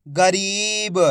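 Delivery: गरीब sounded out with a long ई; drawn-out long ई. Shown as a demonstration. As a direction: stretch री in गरीब. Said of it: "खींच के पढ़ा जाता है".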